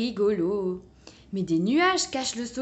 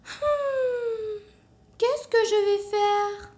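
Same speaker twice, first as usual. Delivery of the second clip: sighing; 12 semitones higher, much slower than usual. A narrator's reading. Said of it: "soupire-t-elle".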